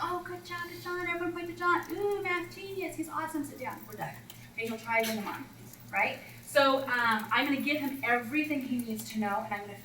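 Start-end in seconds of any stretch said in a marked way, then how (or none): 0.0-4.1 high, bright gushing; gushing praise. Retold or imitated. Coach praises the student leader to the class, high and gushing. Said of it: "I'm like".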